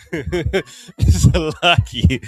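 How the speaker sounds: laughing. Real, not acted. Joking about the late hour.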